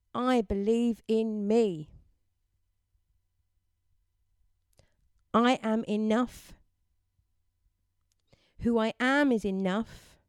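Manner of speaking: affirmations. Shown as a demonstration. Host leading listeners in affirmations to repeat, firm and deliberate. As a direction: state it firmly, leaving room to echo. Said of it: "we're gonna do some affirmations".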